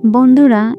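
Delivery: usual speaking voice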